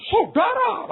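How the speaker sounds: high, loud shouted call; sudden sermon shout. Retold or imitated. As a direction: blast it like a speaker turned up. Boss's sermon blasting from car speaker. (teriakan khotbah yang tiba-tiba keras)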